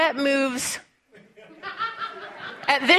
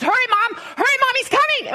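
raised, rushed plea; urgent; 8 semitones higher, faster than usual. Retold or imitated. Son urges mom to hurry, voice climbing. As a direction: hurry her along, voice climbing. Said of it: "[name]'s voice is elevating an octave".

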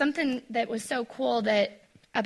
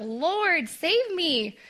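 lightly voiced desperate plea, higher; mild mock cry for help; 7 semitones higher, much slower than usual. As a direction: call out for rescue, only a hint of panic. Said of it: "he cries out".

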